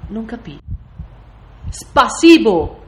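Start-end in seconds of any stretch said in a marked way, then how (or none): 1.7-2.8 loud, drawn-out foreign command; insistent repeat. A narrator's reading. Russian soldier drills the narrator on the word, loud and insistent. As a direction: call it out big and slow, higher.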